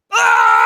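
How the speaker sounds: short loud death scream; death cry. Retold or imitated. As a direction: let out a dying scream. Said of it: "the death screams and just like".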